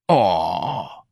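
long-drawn, sorry "aw"; sympathetic. Acted. Santa hearing Irene's heart is broke, sympathetic. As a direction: commiserate with a touch of croak.

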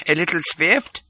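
high little doctor's voice; small, high, uneasy remark; muttering doctor. A narrator's reading. Scared doctor takes the prince's pulse, muttering high. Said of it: "he muttered".

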